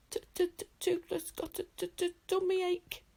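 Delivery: high squeaky little dragon stammering; a tiny dragon faking a tummy ache; stammering whine. A narrator's reading. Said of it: "he complained".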